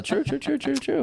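high squeaky repeated agreement; high little squeak of agreement; high-pitched. Acted. Mock agreement, high and quiet.

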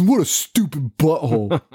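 lightly voiced rude kid; a hint of a snotty kid; mock bratty kid. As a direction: sneer the insult. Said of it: "Just nonstop".

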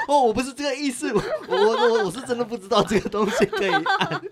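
high, loud, stammering and half-laughing; flustered protest. Retold or imitated. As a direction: blurt it out in a laughing panic. Retells her own panicked explanation.

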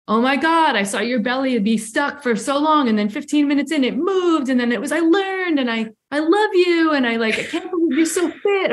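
high, bubbly gushing praise; gushing classmates. Retold or imitated. Imitating the circle of classmates gushing praise at her. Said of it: "Everyone in the circle is like".

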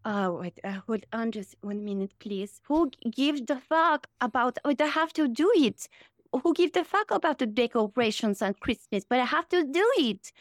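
put-on European accent, complaining to herself; Melania impression. Shown as a demonstration. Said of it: "Can you do Melania?".

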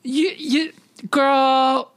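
put-on high, drawn-out sassy Girl. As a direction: scold her like a diva friend. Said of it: "I was like".

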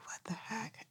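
whispered what the heck; breathy whispered aside; whispering. Retold or imitated. Retold thought, whispered.

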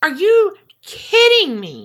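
loud, high incredulous outburst; outraged disbelief. Real, not acted. Co-host learning he served only seven years, outraged. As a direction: burst out high and indignant.

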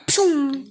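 high whooshing "choom"; imitated zoom-away noise. Parent repeats child's getaway, high whoosh noise. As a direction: whoosh like someone shooting off.

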